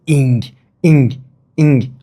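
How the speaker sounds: "ing" said through the nose; nasal ing. Shown as a demonstration. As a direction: sound out "ing" with a nasal ending. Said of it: "It's nasal somehow".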